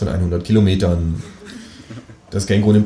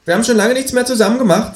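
high, louder reproachful kangaroo voice; 4 dB louder than usual. A narrator's reading. Kangaroo reproaching its flatmate, high reproachful voice. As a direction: whine it at him like a hurt partner. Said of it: "in vorwurfsvollem Ton".

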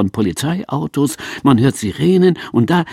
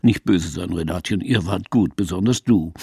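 gentle Manfred Krug; 8 semitones lower, 4 dB quieter than usual. Acted. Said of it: "kniet sich vor Renate hin und sagt".

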